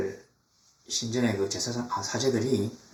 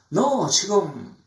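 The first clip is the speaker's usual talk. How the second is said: drawn-out, higher mock reproach; scornful scolding; 6 semitones higher, much slower than usual. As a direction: scold with slow disdain. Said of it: "하면서 코웃음 치는".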